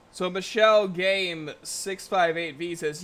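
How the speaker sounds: ordinary delivery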